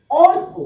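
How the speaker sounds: teacher modelling অর্ক with a clear র; model pronunciation. Shown as a demonstration. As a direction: sound র clearly before ক in অর্ক. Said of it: "আমরা কিভাবে উচ্চারণ".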